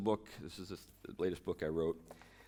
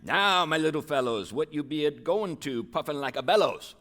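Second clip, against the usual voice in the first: put-on high, loud jolly old man calling; cried the old man; 8 semitones higher, 9 dB louder than usual. Tom calming the panicked hobbits, high loud jolly call. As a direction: boom it out like a merry old fellow. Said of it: "he rhymes a lot".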